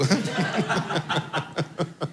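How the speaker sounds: quiet laugh, breathy and drawn out; soft laugh. Real, not acted. Preacher laughing softly at his son's warning. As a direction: chuckle under your breath.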